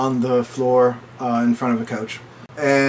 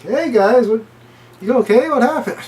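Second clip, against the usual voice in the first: oblivious partygoer; 10 semitones higher than usual. Retold or imitated.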